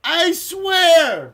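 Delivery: high, loud outcry; loud incredulous yell; exclaiming in disbelief. Real, not acted. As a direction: cry out at an unbelievable result. Disbelief at repeat winner.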